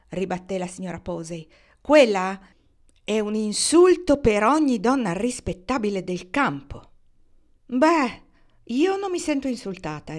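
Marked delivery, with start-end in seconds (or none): 1.8-6.9 loud, high scolding burst; indignant outburst. Acted. Mrs Posey scolds Kendra about Marnie, loud indignant outburst. 7.7-10.2 curt retort. A narrator's reading. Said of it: "ribatté seccamente Kendra".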